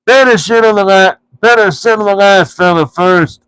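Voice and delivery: a troll choosing his victim; troll Bert picking the last dwarf. A narrator's reading. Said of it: "said Bert".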